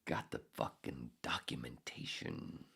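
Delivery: breathy whisper under the breath; a bitter whispered afterthought; whispered aside. Real, not acted. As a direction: whisper it to yourself. Muttered aside after rant.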